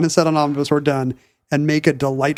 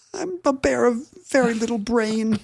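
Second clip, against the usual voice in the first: lightly voiced Pooh bear, softer and higher; a gentle bear of little brain, slightly raised; 7 semitones higher, 4 dB quieter than usual. Pooh bear speaking to Christopher Robin, softer and higher. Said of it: "And Pooh would be like".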